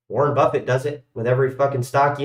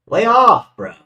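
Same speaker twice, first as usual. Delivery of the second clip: loud, higher playful snap; mock telling off; 11 semitones higher, 9 dB louder than usual. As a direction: jokingly bark at a nosy friend. Mock snap at Alan, then kidding.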